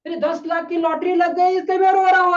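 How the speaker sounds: high, loud wail building as he speaks; loud mock crying. Crying man repeating his lottery news, loud mock wailing. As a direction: imitate a man breaking into loud sobs mid-sentence. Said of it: "बोलते बोलते फिर से जोर से रोने लगा".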